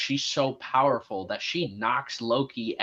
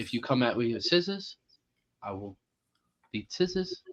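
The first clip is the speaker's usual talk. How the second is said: Korg impression; 3 semitones lower, 6 dB quieter than usual. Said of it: "That's what he does".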